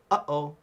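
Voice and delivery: "Uh-oh" with a sudden catch in the throat; glottal stop demo. Shown as a demonstration. Teacher shows the ukulele club the glottal stop in "Uh-oh". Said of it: "if you think of the phrase".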